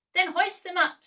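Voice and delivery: high, loud command; barked order. A narrator's reading. Hook ordering his pirates, high and loud. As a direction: bark it at the crew.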